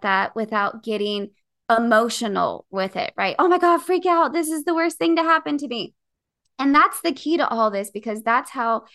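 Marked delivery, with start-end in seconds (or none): 3.4-5.9 high, loud pretend panic; mock freak-out. Showing listeners the anxious mind, mock high panic. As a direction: play the overreacting worrier.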